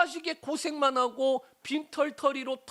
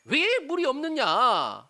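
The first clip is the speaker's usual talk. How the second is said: raised, higher voice arguing back; indignant protest; 6 semitones higher, 4 dB louder than usual. Acted. Preacher as Moses confronting God over no water, indignant protest. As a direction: challenge God with an aggrieved why. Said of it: "하나님 앞에 그렇게 따질 법도".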